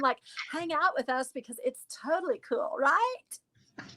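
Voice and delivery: put-on high, upspeaking valley girl from Malibu. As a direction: bubble like a California valley girl. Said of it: "Ann Taylor in Malibu, California".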